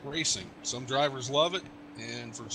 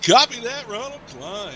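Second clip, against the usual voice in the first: booming announcer voice, much higher and louder; best TV voice; 7 semitones higher, 12 dB louder than usual. Shown as a demonstration. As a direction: project it like a network anchor. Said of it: "I'm using my best T V voice".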